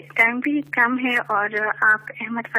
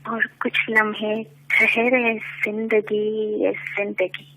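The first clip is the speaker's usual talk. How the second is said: measured poetic recitation, lower; stately nazm recitation; 4 semitones lower, 5 dB quieter than usual. Acted. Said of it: "ایک نظم پیش کرنا چاہوں گی".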